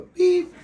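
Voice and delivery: high, loud electronic beep; imitated beep. Man imitating his phone on speaker, high loud beep.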